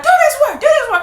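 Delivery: very high, loud nagging; high loud mock nagging. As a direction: hammer the order like an angry grandma. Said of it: "she like".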